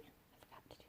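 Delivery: hushed aside; a real whispered aside to the audience. Real, not acted. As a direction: whisper it as a quick confession. Aside to viewers mid-reading.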